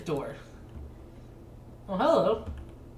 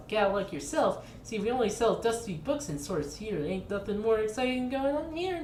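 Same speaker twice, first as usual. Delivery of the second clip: higher folksy cowboy voice, easygoing; 4 semitones higher than usual. Acted. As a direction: play a friendly old-timer welcoming a stranger. Voices old cowboy shopkeeper.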